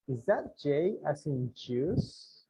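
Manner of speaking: slow, careful model phrase; slow drill. Shown as a demonstration. Teacher drilling the question slowly for the class. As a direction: say it slowly for repetition. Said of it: "aquí le pongo, miren".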